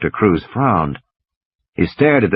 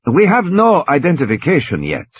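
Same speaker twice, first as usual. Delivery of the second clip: doctor's voice; 7 semitones higher than usual. Acted. Doctor answering the parents.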